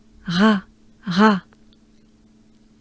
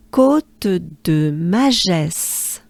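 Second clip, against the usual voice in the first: Côte de Magès even slower, vowels held long; slow together; much slower than usual. Shown as a demonstration. Teacher leads learners slowly through the name together. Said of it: "let's do it together".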